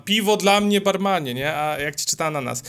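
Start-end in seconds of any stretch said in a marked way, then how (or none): 0.0-1.3 loud, higher call like the Witcher in a tavern; raised voice. Retold or imitated. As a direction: shout the order across the bar, fully into it. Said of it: "i mówi podniesionym głosem tam". (podniesiony głos, z wczuciem)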